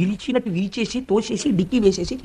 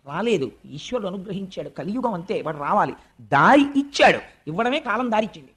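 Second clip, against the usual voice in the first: usual speaking voice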